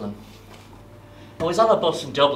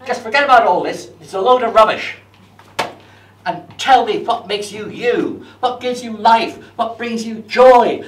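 loud, higher, insistent telling-off; hearty guru voice; 7 semitones higher, 7 dB louder than usual. Retold or imitated. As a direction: boom it like a blunt mentor. Guru berating him loudly.

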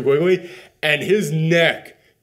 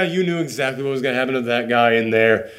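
normal talking voice

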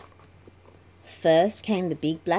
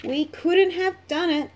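higher, hearty engines' thanks; the big engines giving credit gladly; grateful engines; 10 semitones higher, 4 dB louder than usual. A narrator's reading.

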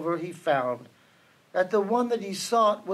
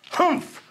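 short, high humph; disgusted humph; 10 semitones higher than usual. A narrator's reading. Kangaroo disapproves of Horton, humphing. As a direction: huff it out in disapproval. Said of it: "humphed a voice".

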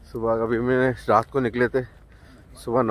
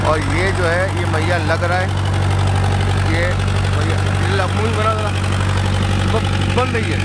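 loud calling out; 8 semitones higher, 7 dB louder than usual. Real, not acted. Calling out on boat deck.

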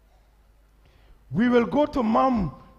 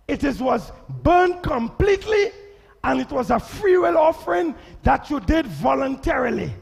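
preaching; 6 semitones higher, 4 dB louder than usual. Acted. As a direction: proclaim it to the congregation. Preaching at full force.

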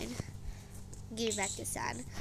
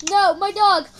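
loud, higher outcry of dismay; alarmed cry; 5 semitones higher, 9 dB louder than usual. Real, not acted. Player sees the dog in danger, alarmed cry. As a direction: cry out for your pet.

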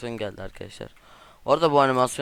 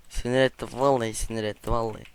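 ordinary talk, nothing marked

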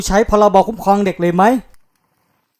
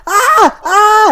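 whiny shout; 11 semitones higher, 4 dB louder than usual. Real, not acted. After being chased, groaning shout.

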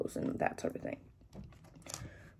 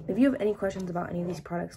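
usual speaking voice

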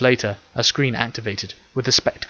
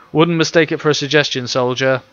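louder, commanding officer voice; firm authority; 4 semitones higher, 5 dB louder than usual. A narrator's reading. Officer ordering the clone to leave, firm command. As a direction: pull rank, no room to argue. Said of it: "for the first time using his true authority".

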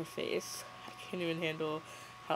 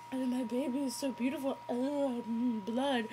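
higher, hurried mock cooing; gush, then get distracted by blood; mock new mother; 5 semitones higher than usual. Mimicking Bella in the birth scene.

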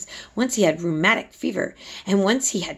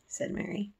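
ordinary delivery

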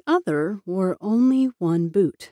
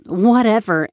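dismissive; 4 semitones higher, 6 dB louder than usual. A narrator's reading. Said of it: "with a dismissive flick".

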